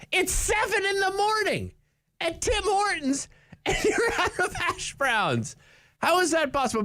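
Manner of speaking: high, exasperated disbelief; incredulous rant. Real, not acted. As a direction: rant in mock outrage at no hash browns. Incredulous rant about hash browns.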